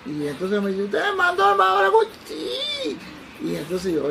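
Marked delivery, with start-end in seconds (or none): 0.8-2.0 fast, high, loud yelled question; imitated shouting. Drunk stranger questioning the doctor, imitated shouting. 2.2-3.0 tiny falsetto squeak, held long; thin yes. Retold or imitated. Doctor replying to the shouting drunk, thin falsetto. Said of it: "el sí me salió un poco fino".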